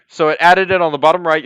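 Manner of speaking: usual speaking voice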